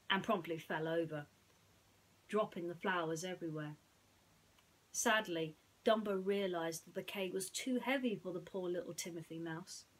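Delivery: ordinary delivery, nothing marked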